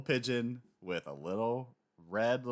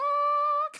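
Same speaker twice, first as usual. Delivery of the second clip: wild squeal; 10 dB louder than usual. Retold or imitated. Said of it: "he's just losing his goddamn mind".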